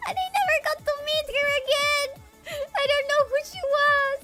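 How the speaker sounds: very high, fast, squeaky. Real, not acted. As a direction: crank the pitch way up, giggly. Pitched-up voice, far timbre.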